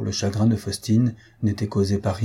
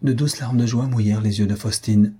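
usual speaking voice

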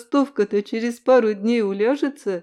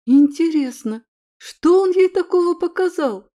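higher, louder amazed wondering; surprised wondering; 8 semitones higher, 4 dB louder than usual. A narrator's reading. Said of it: "вымолвила удивлённая подруга".

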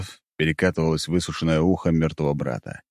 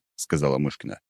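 ordinary delivery, nothing marked

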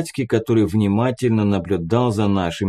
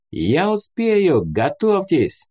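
calling out; 8 semitones higher, slower than usual. A narrator's reading. Man shouts back from the departing vehicle's window, calling out. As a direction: stretch it out like a distant call. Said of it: "крикнул уже в открытое окно".

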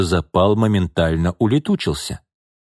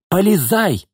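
high, sharp command; ordering; 10 semitones higher, slower than usual. A narrator's reading. Mother forcing daughter into the car, ordering her. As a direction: order the child in at once. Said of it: "приказала она".

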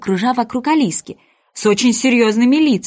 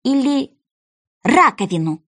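hesitant alternative guess; pause, then offer another word tentatively; unsure; 5 semitones higher than usual. A narrator's reading. Alice guesses at the word, unsure. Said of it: "неуверенно подумала Алиска".

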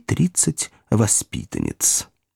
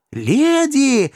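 high, loud call for attention; desperate cry; 3 dB louder than usual. A narrator's reading. Pickwick cries out to the screaming ladies, desperate. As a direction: cry out to be heard over the screams. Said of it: "закричал мистер Пиквик".

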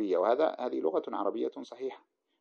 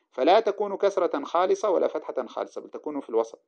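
ordinary talk

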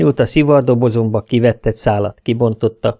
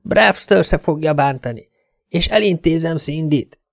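higher voice, bargaining to get out; shut-in captive pleading; 7 semitones higher than usual. A narrator's reading. Szelin bargains with her captor from the locked bathroom, pleading high. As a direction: plead in a high voice from behind a door. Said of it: "szólt Szelim bentről".

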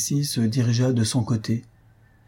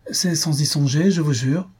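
higher-pitched, hasty protest; quick reply; 6 semitones higher than usual. A narrator's reading. Young girl excusing herself to the old man, quick protest. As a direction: hurry to deny it in a raised pitch. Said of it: "répondit vivement Minella".

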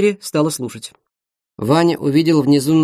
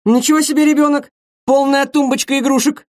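put-on very high-pitched girlfriend's comeback; high, heated acted retort; indignant retort; 10 semitones higher than usual. A narrator's reading. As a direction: fire back in a high, scornful squeal of words.

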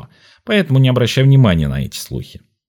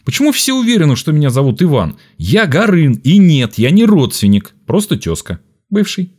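flares up, higher and loud; angry outburst; 7 semitones higher, 3 dB louder than usual. Acted. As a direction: snap back at being called Ivan. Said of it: "Игорь не выдержал и вспылил".

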